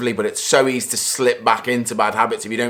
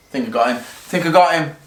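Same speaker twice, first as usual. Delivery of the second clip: ordinary talk, nothing marked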